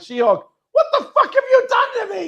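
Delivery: very high, laughing outraged cry; laughing She-Hulk yell. Retold or imitated. Cousin waking up transformed, yelling at Bruce laughing. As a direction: shriek it through laughter, outraged. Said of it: "his cousin who's like".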